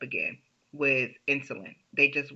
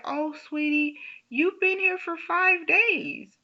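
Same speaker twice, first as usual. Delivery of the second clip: sweet nurse voice; 14 semitones higher than usual. Retold or imitated. Nurse breaking news sweetly to her waking patient, in a much higher coo.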